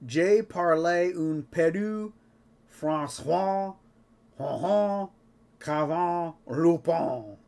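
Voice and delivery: slow, loud, hammed-up French accent; exaggerated French accent. Shown as a demonstration. Podcast host showing how he would pronounce the French, in a slow hammed-up French accent. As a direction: drag out each word in a mock French accent. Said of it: "Here's how I would pronounce all of that".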